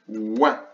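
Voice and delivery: P and "ouin" sounded out as parts; sounding out syllable. Shown as a demonstration. Teacher breaking down a dictated syllable for pupils, sounding it out. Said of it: "accrocher le son ouin".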